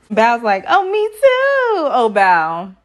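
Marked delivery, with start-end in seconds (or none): 0.7-1.9 high, slow mock squeak of Bao; squeaky imitation. Recapping Bao agreeing with Johnny, in a high mock squeak. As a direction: stretch it out in a tiny high voice.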